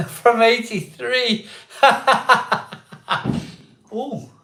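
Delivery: high, slow, giggling punchline; laughing at his own Pepsi joke; laughing through it. Real, not acted. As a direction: crack up while finishing the joke.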